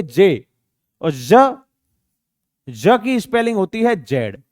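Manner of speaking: ordinary talk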